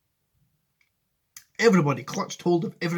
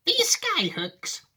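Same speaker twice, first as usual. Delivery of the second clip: put-on high, drawn-out old lady; Grandma Josephine; much slower than usual. Grandma querying Wonka's skyhooks, high and slow. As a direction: question it slowly in a high granny voice. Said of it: "said Grandma Josephine".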